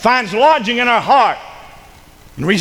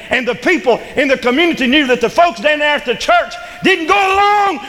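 rising, higher, fervent pulpit preaching; preaching at full force; 5 semitones higher than usual. Acted. Pastor stirring his church to righteous anger, full force preaching. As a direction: build the fire and drive it home.

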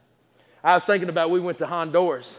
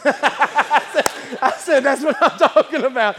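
high-pitched, laughing through the words; tickled by your own story; 9 semitones higher, 6 dB louder, slower than usual. Real, not acted. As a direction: burst out laughing and keep talking.

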